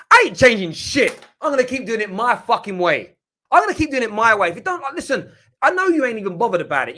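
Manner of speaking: loud, high defiant outburst. Real, not acted. Streamer defying angry fans in his chat, loudly. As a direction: refuse to back down, shouting.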